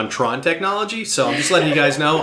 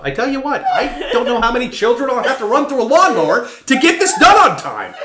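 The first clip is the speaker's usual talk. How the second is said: higher, laughing villain rant; villain cracking up mid-threat; 9 semitones higher, 4 dB louder than usual. Acted.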